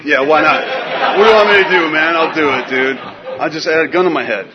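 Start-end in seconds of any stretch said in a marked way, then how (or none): 0.0-3.0 loud, raised-pitch willingness; eager compliance. Acted. Desperate newcomer agreeing to anything his sponsor asks, eager compliance. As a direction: throw yourself at it, loudly. Eager yes to any order.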